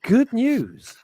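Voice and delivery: excited announcement. Pretend. Announcing the show's good news.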